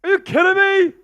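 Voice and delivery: very loud, high-pitched protest; outraged shout. Real, not acted. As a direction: yell in disbelief at the insult. Protesting co-host's jab at his team.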